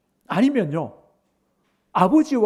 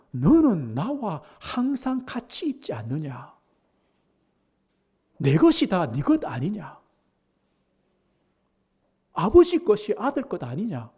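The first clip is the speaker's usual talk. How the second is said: pleading father; 5 semitones higher than usual. Retold or imitated. Father voiced in sermon.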